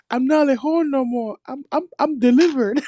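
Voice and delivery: high mock female voice, close to laughing; pleading woman. Playing a woman claiming deliverance, high mock voice. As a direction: imitate a woman protesting she has reformed. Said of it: "she'll be like".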